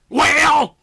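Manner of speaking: sharp loud bark of a single word. A narrator's reading. Detective barks at the sergeant, loud and sharp. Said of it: "Andrew barked".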